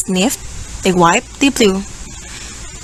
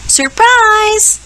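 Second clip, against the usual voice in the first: shouting surprise; 11 semitones higher than usual. Acted. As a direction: yell it out to surprise. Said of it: "They shout".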